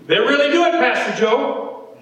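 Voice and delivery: high, emphatic assertion. Real, not acted. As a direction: lift the pitch to press the point. Same warning to two pastors.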